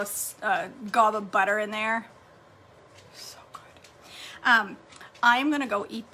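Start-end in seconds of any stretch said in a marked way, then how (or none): 3.1-3.9 breathy whisper of delight. Real, not acted. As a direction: whisper it to yourself. Savoring food, aside.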